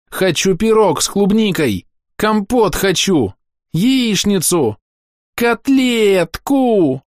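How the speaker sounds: put-on high, slow greedy little girl whine; whining child. A narrator's reading. Hungry girl lists treats to the adults, high and whiny. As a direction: stretch each dish out like a hungry brat.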